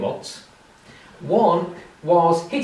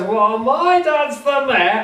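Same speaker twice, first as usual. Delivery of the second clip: boastful lad; 9 semitones higher, 5 dB louder, slower than usual. Retold or imitated. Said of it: "he stands up and he says".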